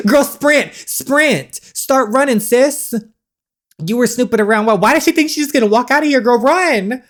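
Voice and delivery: high, loud urging to run; excited yelling. Real, not acted. Player yelling at the snooping girl to run, excited and loud. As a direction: cheer her on to flee, loud and high. Urges the character to flee.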